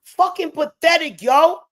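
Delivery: disgusted outburst. Real, not acted. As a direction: spit it out in contempt.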